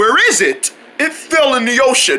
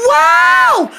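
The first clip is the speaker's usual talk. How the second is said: startled cry; 4 dB louder than usual. Acted. Cartoon characters panic at monster.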